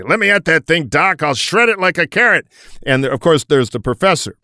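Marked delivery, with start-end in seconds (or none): 0.0-2.5 loud, higher gung-ho soldier; tough Air Force guy. Retold or imitated. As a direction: bark it like an eager fighter pilot. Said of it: "the two-fisted Air Force guy".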